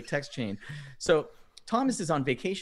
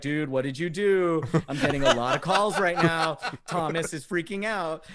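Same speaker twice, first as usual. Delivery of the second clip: higher-pitched worried friend, lightly acted; retold alarm; 5 semitones higher than usual. Friend's voicemail after the fake texts, mock alarmed. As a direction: imitate a friend's concerned voicemail.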